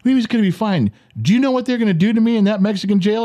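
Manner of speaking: high, fast imitation of an upset wife; mocking retort. Wife to husband about Mexican jail, imitated mocking retort. Wife throws his reassurance back.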